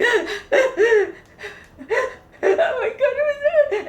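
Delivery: loud, high gasping mock sobs; hysterical crying. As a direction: wail it through hammed-up tears. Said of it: "I am hysterical crying".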